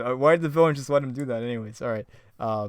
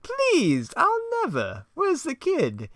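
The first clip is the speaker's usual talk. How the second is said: high taunting clown; mock him like a cartoon villain; Joker voice; 5 dB louder, much slower than usual. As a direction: Joker teasing Batman, high voice.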